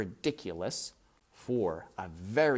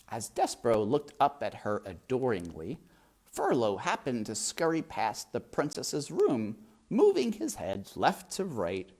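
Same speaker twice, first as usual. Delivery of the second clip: ordinary delivery, nothing marked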